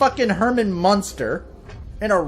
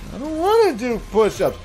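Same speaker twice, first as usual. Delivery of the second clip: put-on whiny kid; 6 semitones higher than usual. Kid refuses.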